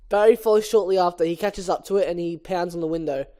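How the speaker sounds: ordinary talk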